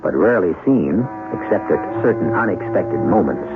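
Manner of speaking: measured, teasing mystery-host read; radio host narration. Acted. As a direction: draw the listener into the next act. Host narrates between acts.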